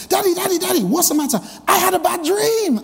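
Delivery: put-on high, eager child calling her daddy; excited little girl. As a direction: bounce it like a thrilled kid. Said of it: "big, excited".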